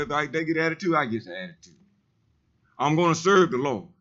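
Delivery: ordinary delivery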